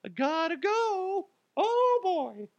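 very high, small slow squeak; tiny falsetto, slipping away unnoticed; sneaking culprit. Acted. Hat thief backs away from the crowd, high squeaky voice.

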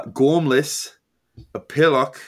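British insults, read out word by word; British accent. Acted. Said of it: "should I try to do it in a British accent?".

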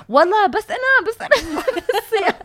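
very high retold pleading, breaking into laughter; high-pitched insistence. Crash victim to disbelieving cop, high-pitched insistence.

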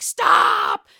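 low, loud bark; hammed-up yell; mock shout. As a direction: bellow it like a big man holding someone back. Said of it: "he's like".

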